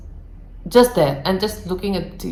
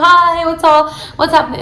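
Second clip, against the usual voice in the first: higher and louder, bubbly and cheerful; happy greeting; 8 semitones higher, 5 dB louder than usual. Retold or imitated. Priya cheerfully greets her furious roommate, bright and upbeat. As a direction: bounce in like nothing's wrong. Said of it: "Priya was in a happy mood".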